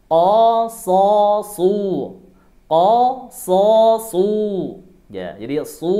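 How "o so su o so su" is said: higher and louder, heavy "so, su"; thick su. Shown as a demonstration. Teacher drills "O, so, su" for students, high and loud. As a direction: call out "O, so, su" full from the back. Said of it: "kemudian didomahkan".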